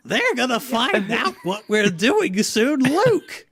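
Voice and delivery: high, loud cartoon mayor voice, laughing through it; mayor of Townsville voice. Sen warns Luke in a hammed-up cartoon mayor voice. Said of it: "But he's mayor of Townsville".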